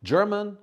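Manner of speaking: German called out high and loud; stressed German. Shown as a demonstration. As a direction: announce German brightly to start the drill. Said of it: "Very colder is wrong".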